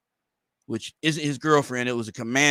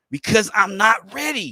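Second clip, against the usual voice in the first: raised, higher mock yell; half-hearted shout of refusal; mock scream; 8 semitones higher, 5 dB louder than usual. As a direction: yell it like he can't take it. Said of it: "he screams out".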